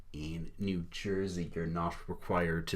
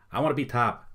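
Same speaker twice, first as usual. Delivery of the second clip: loud, raised imitation of all-caps typing; mock shout; 7 semitones higher, 6 dB louder than usual. Imitating the chat guy shouting his all-caps message. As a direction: belt it out like a caps-lock shout. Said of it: "he's just shouting this at the top of his lungs".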